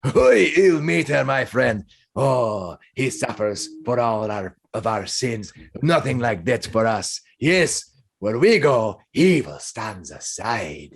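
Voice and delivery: big slow hail, sinking to reverence; earnest warrior in character, stretching the last words; hearty Minsk. Acted. Balding man praises the paladin's god, earnest.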